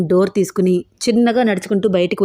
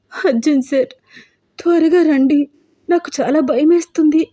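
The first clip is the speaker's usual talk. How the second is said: high-pitched, pleading for rescue; acted tearful cry for help; tearful, frightened; 6 semitones higher than usual. A narrator's reading. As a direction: plead as if crying, voice pitched well up. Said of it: "ఏడుస్తోంది".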